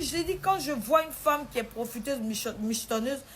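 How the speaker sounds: stern father insisting he knows. Retold or imitated. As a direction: lay down the law loudly. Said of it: "Il dit".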